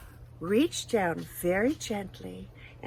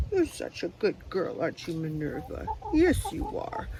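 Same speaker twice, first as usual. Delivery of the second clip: tender cooing; 5 semitones lower, 5 dB quieter than usual. Real, not acted. Cooing to the hen.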